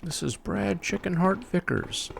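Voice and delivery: mock the cowardly pilot with a sneer; mock radio announcement. Streamer mocks the game's pilot, hammed-up parody.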